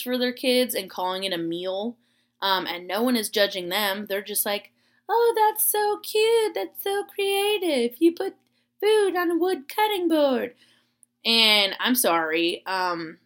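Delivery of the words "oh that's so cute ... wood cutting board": high, loud, drawn-out cooing praise; mock gushing. Onlookers praise parents' charcuterie boards, mock gushing. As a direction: gush in a sugary put-on high voice. Mocking people admiring kids' food boards.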